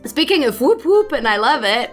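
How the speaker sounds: normal talking voice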